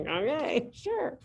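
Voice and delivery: high, doubtful "sure"; mock agreement. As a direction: go along while unconvinced. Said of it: "I'm like".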